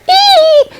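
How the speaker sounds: put-on very high excited baby squeal; tiny baby shriek of delight. A narrator's reading. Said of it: "Esther went".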